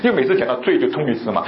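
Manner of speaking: usual speaking voice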